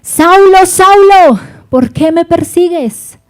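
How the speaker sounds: loud, high, booming divine call; thundering voice of the Lord calling Saulo. Acted. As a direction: call out as God from heaven. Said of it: "le aparece y le dice".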